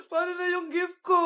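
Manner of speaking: high, tearful whine; mock wailing. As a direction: wail about it like a child. Consoled child laments missing gift. (paiyak na nagmamaktol)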